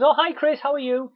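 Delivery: cheery greeting. Pretend. Salesman greeting a customer he knows, cheerily upbeat. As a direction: greet him like a pleased old friend. Friendly greeting acted for trainees.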